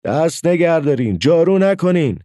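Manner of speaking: urgent, high-pitched call to halt; sudden shout. A narrator's reading. Mother suddenly stops the children sweeping, urgent shout. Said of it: "ناگهان مادرم داد زد".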